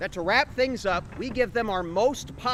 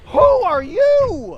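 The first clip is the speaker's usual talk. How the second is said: startled; 11 semitones higher, 6 dB louder, much slower than usual. Acted. Startled by sudden apparition.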